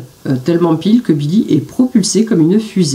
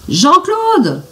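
higher shouted call of a name; shouting; 10 semitones higher than usual. A narrator's reading. Billy calling Jean-Claude from the tree, shouting. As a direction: call him from the top of the tree. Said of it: "crie-t-il".

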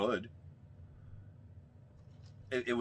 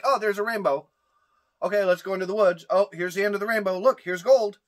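higher, brisk mocking quote; mock-naive discovery; 5 semitones higher, 4 dB louder than usual. Host mocks the movie's easy find, higher faux-excited voice. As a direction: breeze through it like it's all too easy. Mocks characters finding gold instantly.

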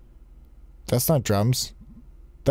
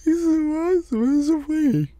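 high, swooning praise with a stressed so; gushing fangirl; 8 semitones higher than usual. Pretend. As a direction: melt over him like a smitten fan.

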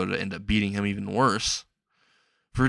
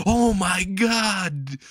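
high, drawn-out panicked wail; hammed-up scream of disbelief; mock scream; 10 semitones higher, 3 dB louder, much slower than usual. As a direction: cry out slowly, high and loud. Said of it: "he screams out".

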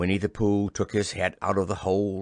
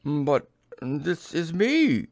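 slow, higher-pitched puzzlement; very much surprised; 4 semitones higher, 3 dB louder, slower than usual. A narrator's reading. Pooh learning Rabbit has gone to see him, surprised. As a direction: stretch it out in mild astonishment. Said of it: "very much surprised".

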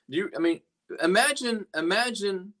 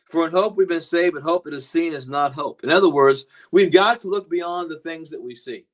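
preaching loud; 9 dB louder than usual. Acted. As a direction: proclaim it to the congregation. Preacher proclaims scripture again.

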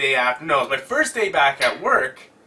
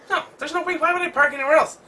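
disbelief; 13 semitones higher, faster than usual. Retold or imitated. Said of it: "and I'm like".

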